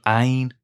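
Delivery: Ain, Wayne without its W; missing W. Shown as a demonstration. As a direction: start Ain straight on the vowel. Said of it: "replace the W in Wayne".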